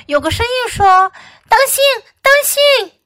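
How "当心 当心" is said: high, loud warning cry; calling out. A narrator's reading. A voice warning Fox, calling out. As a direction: shout to warn someone in danger.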